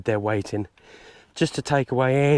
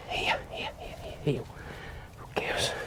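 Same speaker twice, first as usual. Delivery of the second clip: repeated whispered here to the dogs; urgent whisper; 11 dB quieter than usual. Real, not acted. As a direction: hush the dogs in with a breathy call. Whispering dogs in during drive.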